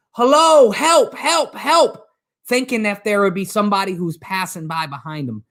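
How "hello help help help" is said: high, drawn-out cries for help; panicked scream. Acted. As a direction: act out a hiker calling out in panic. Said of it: "he's screaming out loud".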